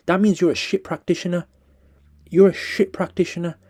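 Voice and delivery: heated, loud angry rant; scathing critique. Real, not acted. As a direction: tear into him, voice rising.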